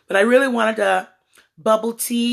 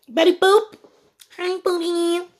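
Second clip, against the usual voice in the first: cute greeting; 9 semitones higher than usual. Real, not acted. Greets viewer playfully high.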